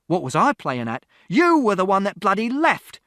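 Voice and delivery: rising into a high, loud bitter yell; bitter shout. A narrator's reading. Father to mother who left, bitter shout. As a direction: turn the blame on her. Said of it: "And Father shouted".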